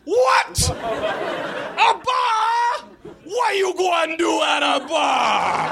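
put-on high, drawn-out Jamaican-accented yell; outraged Jamaican cabbie. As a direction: yell in utter Jamaican disbelief, stretching the last word. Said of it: "he thinks he's talking to a baby".

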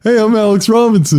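high, put-on self-introduction; hammed-up impression of yourself; playing himself. Shown as a demonstration. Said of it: "Now you're playing it".

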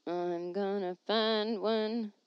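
slow, stretched determined repeat; slow drawn-out. Real, not acted. She scrolls for an email, dragging the words out slowly. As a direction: stretch every word while searching.

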